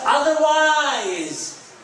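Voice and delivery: high, long drawn-out cry; impassioned, soaring warning; preacher's warning cry. Real, not acted. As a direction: stretch the word out as a ringing warning. Warning at sermon's climax.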